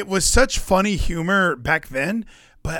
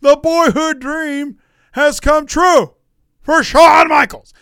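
slow, high, booming announcer call; a wrestling announcer's slow high shout; Vince McMahon announcing; 11 semitones higher, 7 dB louder, slower than usual. Retold or imitated. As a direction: bellow it like a ringside announcer. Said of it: "and Vince McMahon says".

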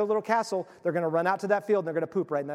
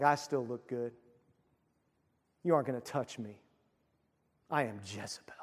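much softer, low and haughty; quietly smug, sure of her own beauty; proud Jezebel; 8 semitones lower, 8 dB quieter than usual. Acted. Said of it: "She's like".

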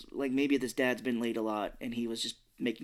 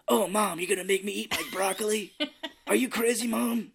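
put-on grown man as kid; 9 semitones higher than usual. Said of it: "if he's like".

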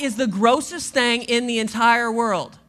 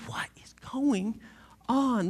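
hushed, breathy whisper of the question; 2 semitones lower, 6 dB quieter, slower than usual. Acted. Preacher pondering Jesus's odd command, hushed whisper. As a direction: lean in and whisper it.

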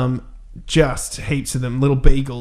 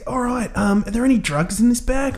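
higher-pitched questioning officer; mock cop; 9 semitones higher than usual. Cop asking about a bag, higher mock-official voice.

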